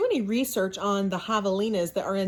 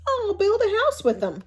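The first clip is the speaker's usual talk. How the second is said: much higher, bright little-pig character voice; little javelina voice; 12 semitones higher, 5 dB louder than usual. Acted. The first little javelina deciding on his tumbleweed house, in a high piggy voice. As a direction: squeak it out like a proud little javelina.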